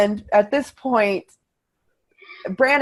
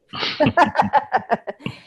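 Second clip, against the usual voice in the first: high laugh; 8 semitones higher, 4 dB louder than usual. Real, not acted. Laugh after "take my money.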